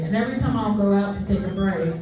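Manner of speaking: ordinary talk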